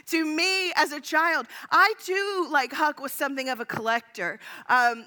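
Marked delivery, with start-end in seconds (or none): none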